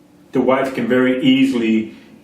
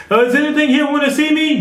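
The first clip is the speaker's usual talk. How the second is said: high, louder mock calling; mock searcher; 13 semitones higher, 4 dB louder than usual. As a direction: poke fun at the seeker. Mocking someone searching caves.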